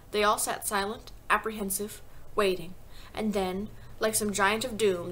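usual speaking voice

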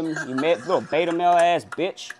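usual speaking voice

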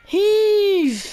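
high, loud drawn-out call; shouted heave. Real, not acted. Player calling out mid-match, loud high shout. Calling out during game play.

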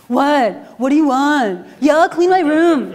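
put-on bratty teen snapping back at mom; mock sulky teenager. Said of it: "That was me".

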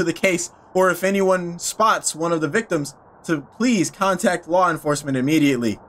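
news report reading. Acted. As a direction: read it like a grave news bulletin. Reads newspaper article aloud.

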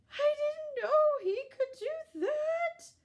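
very high, slow amazed voice; astonished kitten. A narrator's reading. Kitten stunned by the duck, high slow amazed wail. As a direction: wail it out in shock.

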